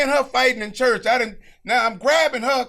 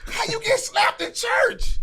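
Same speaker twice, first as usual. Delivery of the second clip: incredulous laugh-question; 14 semitones higher, slower than usual. Real, not acted. Incredulous at himself.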